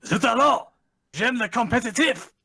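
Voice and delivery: high, hammy French chef; overdone French impression; French accent. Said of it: "the impression needs a little work".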